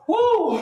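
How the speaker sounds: high whooping exhale; effort whoop. Real, not acted. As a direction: let out a high woo. Exhale after set.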